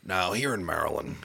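lightly voiced governor; a hint of a folksy politician; Larry Hogan impression. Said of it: "Larry Hogan here".

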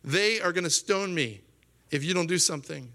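acted urgent appeal; crying out. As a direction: cry out for help, as if desperate. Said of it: "Moses cried out to the Lord".

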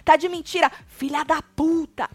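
high, shouted insults, drawn out at the end; mock furious tirade. [name] calling Kali a liar, mock furious tirade. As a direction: fling each insult in a hammed-up rage.

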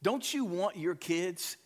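usual speaking voice

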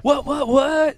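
loud, high, stretched-out repeated shouts; mock hyped commentator hollering; shoutcaster yell. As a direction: yell each one way up high. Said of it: "Yeah, I just yelled".

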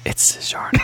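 breathy whispered echo; ASMR whisper. Pretend. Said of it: "a little A S M R".

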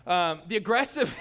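loud jokey aggressive burst; mock aggressive shout. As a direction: bark it like an overeager hothead. Joking about aggressive energy.

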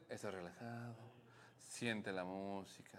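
hushed mock relaxation guide; guided meditation. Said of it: "No puede decir".

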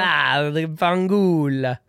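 slow hammed-up Italian accent; playful Italian accent curse; mock Italian.

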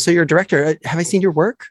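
high-pitched mock curiosity; imitated nosy questioner. Someone quizzing a director about their work, imitated curiosity. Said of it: "when someone says".

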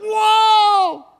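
very high, very loud drawn-out "whoa"; amazed kid's whoa. Acted. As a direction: holler it in hammed-up amazement. Kid amazed after missing again.

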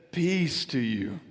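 hushed low greeting of peace; gentle. Acted. Jesus speaks gently to disciples.

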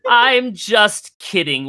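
put-on high, slow teasing old voice; teasing old mouse. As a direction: let the kids off the hook, playfully. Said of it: "He's like".

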